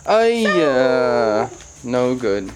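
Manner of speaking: ordinary talk, nothing marked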